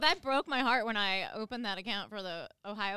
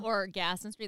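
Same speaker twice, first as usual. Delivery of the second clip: ordinary talk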